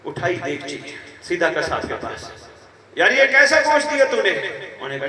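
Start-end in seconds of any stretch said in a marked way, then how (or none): 3.0-4.3 loud, high angry demand; angry complaint. Retold or imitated. Merchant yelling at butcher over meat, loud and high. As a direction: storm up to the butcher and yell it.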